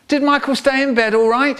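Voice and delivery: lightly voiced mum, lilting up at the end; mum calling. Mum asking through the door.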